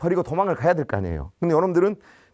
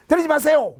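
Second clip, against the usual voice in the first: high, loud cry to stop them; retold yell; 10 semitones higher, 4 dB louder than usual. Teacher yells at the officer beating his students, high and loud. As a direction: shout to make the police officer stop the slapping. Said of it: "이게 나도 모르게 악을 썼어요".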